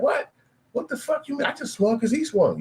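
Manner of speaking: high, outraged what; indignant disbelief. Retold or imitated. Young guy told he's going to jail, high outraged disbelief. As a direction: squeal it like you can't believe it. Guy baffled at being jailed.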